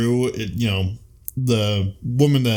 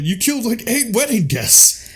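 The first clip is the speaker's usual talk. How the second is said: outraged Lord; 10 semitones higher than usual. Retold or imitated. The Lord scolding Lancelot over the wedding guests, high indignant squeal. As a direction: squeal it out like a scandalized nobleman. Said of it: "the Lord comes in and he's just like".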